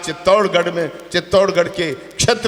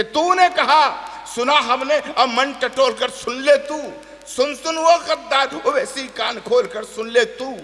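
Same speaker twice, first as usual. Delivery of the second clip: furious challenge; 6 semitones higher, slower than usual. Acted. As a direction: hurl the last lines as a challenge to a traitor. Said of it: "अब जितना आक्रोश हो चौथी पंक्ति में लौटाना".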